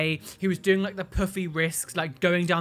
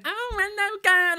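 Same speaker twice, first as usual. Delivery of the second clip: much higher, sing-song camp greeting; puffy camp voice; 13 semitones higher than usual. Retold or imitated. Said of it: "the puffy voice".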